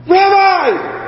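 high, overjoyed cry; an acted burst of delighted shock; excited outcry. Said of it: "how did she respond".

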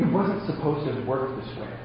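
ordinary talk

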